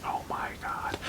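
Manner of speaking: breathless whispered shock; barely-there horrified whisper; stunned whisper. Retold or imitated. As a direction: whisper it in horror. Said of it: "you're just like".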